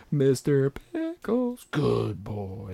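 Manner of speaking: high, slow doggy talk; pet voice. Acted. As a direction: coo it like praising a dog. Cooing the dog's name.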